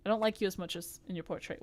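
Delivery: usual speaking voice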